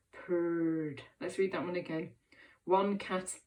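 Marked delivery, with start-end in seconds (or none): 0.1-1.0 "purred" drawn out low like a cat; purring. Shown as a demonstration. Teacher reading with children, purring like a cat. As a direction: roll the word out low and long. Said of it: "in a meowing and purring sort of way".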